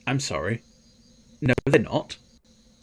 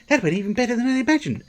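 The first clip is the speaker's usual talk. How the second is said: fast, very high-pitched delight; high, hurried, tickled; delighted squeak; 13 semitones higher, much faster than usual. Real, not acted. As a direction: squeal it out in a rush. Delighted squeal after silly noise.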